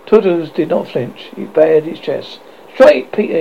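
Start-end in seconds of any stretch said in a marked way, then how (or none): 2.7-3.4 high, loud order; firm command. A narrator's reading. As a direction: urge him firmly in a raised voice. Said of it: "he said firmly".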